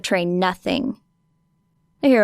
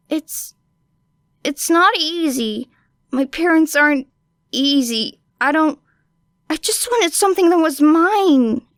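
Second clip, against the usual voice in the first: acted angry hurt; 7 semitones higher than usual. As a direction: let the anger slip out too much. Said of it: "A little too much anger in that one".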